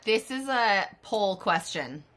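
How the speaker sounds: ordinary talk, nothing marked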